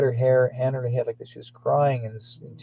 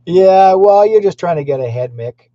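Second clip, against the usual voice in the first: loud teasing; 7 semitones higher, 11 dB louder than usual. Real, not acted. Host ribbing viewer Mick about audio, loud teasing. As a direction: tease loudly, coming down from a laugh. Teases viewer after laughing.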